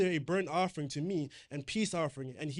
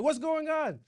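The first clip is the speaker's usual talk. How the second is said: much higher, louder alarmed cry; panicked cry; 10 semitones higher, 6 dB louder, slower than usual. Acted. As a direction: call out in sudden alarm. Saul seeing people scatter.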